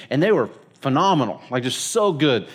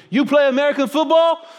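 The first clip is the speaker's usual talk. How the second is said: much higher, louder teasing voice; Herbie's broken-English question; 10 semitones higher, 3 dB louder than usual. Retold or imitated. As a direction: quiz him with a grin.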